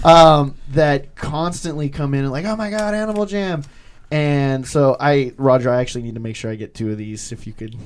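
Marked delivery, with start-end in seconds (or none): 2.4-3.6 put-on excited kids.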